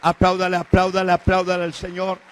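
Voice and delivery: loud, driving chant; rally the church to clap; call for applause. Acted. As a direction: pastor urging the congregation to applaud, loud. Calls for applause.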